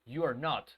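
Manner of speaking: you are NOT, with not said in full and stressed. Shown as a demonstration. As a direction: make not land clearly. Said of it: "necesito destacar esta negación".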